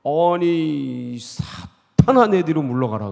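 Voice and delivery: loud, high, dragged-out cry of "사탄아"; sharp rebuke. Retold or imitated. Jesus suddenly turning on Peter with a loud rebuke. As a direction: throw the command out like a sudden reprimand. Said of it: "주님께서 막 칭찬하시고 격려해 주셨는데".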